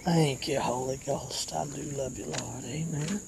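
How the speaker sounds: low, soft prayer trailing away; hushed, rolling devotional refrain; murmured praise. Real, not acted. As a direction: murmur thanks to God under the preaching. Quiet private praise in pauses.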